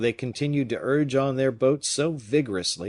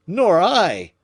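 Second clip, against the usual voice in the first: much higher and louder cry; 10 semitones higher, 10 dB louder than usual. Acted. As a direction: burst out in bafflement. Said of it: "cried Gourville".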